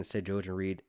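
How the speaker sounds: ordinary talk, nothing marked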